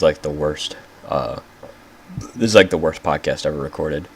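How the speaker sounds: ordinary talk, nothing marked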